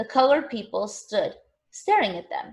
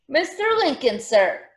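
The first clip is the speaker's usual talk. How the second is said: higher, called-out answer; calling out; 6 semitones higher, 4 dB louder than usual. A narrator's reading. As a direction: call out an answer from the crowd. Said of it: "Nellie called".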